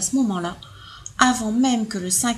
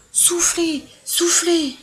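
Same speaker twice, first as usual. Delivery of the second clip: eager urging; 7 semitones higher, much slower than usual. Acted. Elephants urging to blow.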